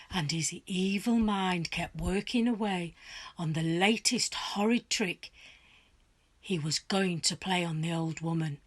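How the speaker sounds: ordinary delivery, nothing marked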